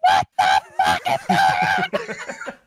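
low, slow, loud mom yelling, with a laugh; angry mom shout. Retold or imitated. Mom bursts in on screaming boys, slow loud low yell. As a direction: bellow it like a furious mom. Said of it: "she's like".

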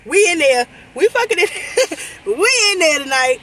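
high, loud whooping with laughs; gleeful excitement. Real, not acted. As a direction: crow it in real excitement, laughing. Celebrating being at the shops.

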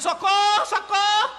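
high, loud panicked cry; screaming for help. Acted. Inspector chased by an angry bull, panicked scream. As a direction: yell for help as the bull charges. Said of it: "Ele veio gritando".

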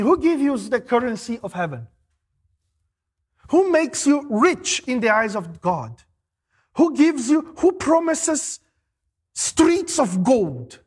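slowing right down to streets of gold; slow rhetorical build. Real, not acted. Preacher questioning the congregation, slowing to a weighty climax. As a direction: stretch out each question.

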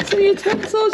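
high gleeful "Some utensils"; excited repeat. Real, not acted. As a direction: repeat it with delight.

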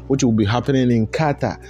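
ordinary talk, nothing marked

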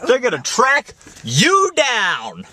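hammed-up doom, dragging the words; mock ominous. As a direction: stretch it out like a dramatic warning. Mock menace echoing her worry.

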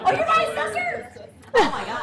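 high, amused disbelief; real startled delight at a familiar face; delighted surprise. Real, not acted. Congresswoman spotting her own assessor in the audience, surprised laugh.

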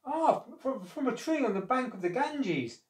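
high-pitched, stumbling answer; hesitant crocodile reply. A narrator's reading. Crocodile explaining fruit to his wife, hesitant and high.

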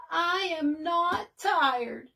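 a touch of tearful protest, high and slow; mild cry. A narrator's reading. Bird crying that he is not tired, mildly tearful. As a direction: insist through tears. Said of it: "he cried".

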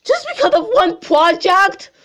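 high, loud disbelieving question; incredulous. Acted. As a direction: scoff at it in disbelief. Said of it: "reading in mock disbelief".

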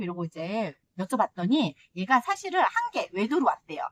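usual speaking voice

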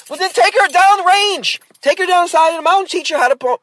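high, loud, exasperated retort; exasperated yelling. Retold or imitated. Storyteller telling mom to teach her daughter shooting, exasperated yelling. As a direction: raise pitch and volume in fed-up insistence.

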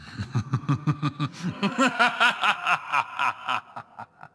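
long gloating evil laugh; villain laugh. Acted. Villain scoffing at the intruder with a gloating laugh. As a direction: cackle down at the intruder, rising then falling.

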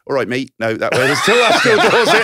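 laughing, hammed-up Northern "All right, mate"; a bad try at a Northern accent, going high and loud with laughter; mock Northern accent. Asked to do a Northern accent, laughing mock Northern voice. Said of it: "Have another go".

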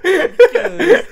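"Because" high and loud, stretched out while laughing; exaggerated odd pronunciation. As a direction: laughingly overdo a silly "Because". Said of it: "I didn't say it like that".

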